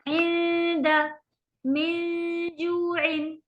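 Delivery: nasal noon held long in इंद मिन जुइन; slow Quranic recitation with ghunna; ghunna demo. Shown as a demonstration. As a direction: stretch the hidden noon through the nose, slowly. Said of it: "और फिर हम नून को खींचते हैं".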